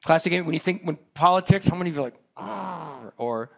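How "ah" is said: mock angry growl. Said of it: "How about angry face?".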